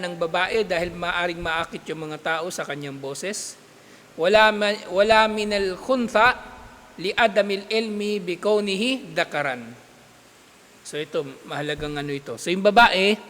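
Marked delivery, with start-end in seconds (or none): 4.1-9.6 rapid textbook Arabic read aloud; fast Arabic recitation. Acted. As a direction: rattle off the Arabic text quickly. Reads Arabic text quickly.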